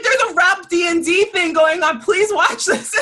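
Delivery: high, loud, urgent excitement; excited. Retold or imitated. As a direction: beg them to watch, thrilled. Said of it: "I'm like".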